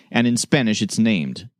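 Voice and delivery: ordinary delivery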